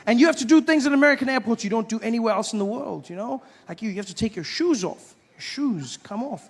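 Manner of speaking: ordinary talk, nothing marked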